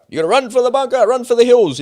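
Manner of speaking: high, alarmed call to flee; mock panic. To viewers, mimicking the drill's panic, high mock alarm. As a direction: sound the alarm like it's the real thing.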